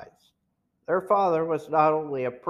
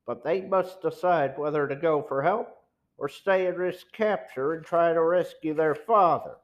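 ordinary delivery

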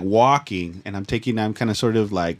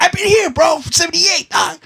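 high-pitched, loud mock old man calling out; 5 dB louder than usual. Old man in a wheelchair calling out to the runner, high and loud. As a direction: yell like a grumpy old man flagging someone down. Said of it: "He's like".

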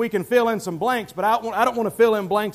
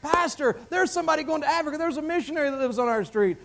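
higher, fast, breathless telling; eager news; 7 semitones higher, faster than usual. Pretend. Neighbour rushing to tell the pastor, eager and fast. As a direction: rush to tell the pastor, pitch raised.

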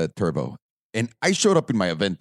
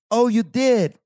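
put-on butchered British accent; 2 semitones higher than usual. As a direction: gush condescendingly in a fake British accent. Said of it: "it's a British lady".

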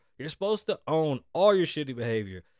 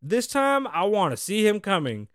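high, loud mock challenge; loud fighter quote; 7 semitones higher, 5 dB louder than usual. Fake Logan Paul shouting his challenge.

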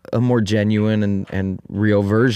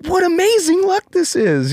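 very high gushing villagers; envious villagers; 4 dB louder than usual. Retold or imitated. Villagers to the farmer, gushing very high. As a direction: squeal over his amazing luck.

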